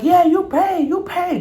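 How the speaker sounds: very high mocking landlord voice; shrill landlord. Landlord demanding rent, high and shrill. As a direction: squeal the demand. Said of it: "she's like".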